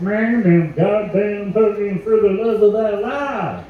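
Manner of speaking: high, slow, put-on Kentucky drawl; Kentucky accent. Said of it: "you give 'em a fucking Kentucky accent".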